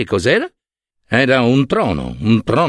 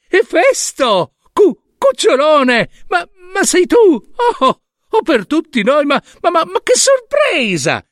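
Hera, falsely delighted. Acted. Hera greeting her returned son with much higher, falsely overjoyed voice. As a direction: gush over him with a big fake smile.

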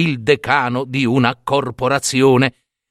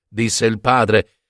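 ordinary delivery, nothing marked

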